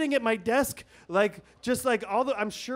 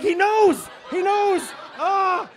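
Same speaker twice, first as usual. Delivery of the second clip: much higher, louder panicked yell; panicked shout; 12 semitones higher, 9 dB louder, slower than usual. Retold or imitated. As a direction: scream it like you're about to be busted. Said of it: "And, and I'm like".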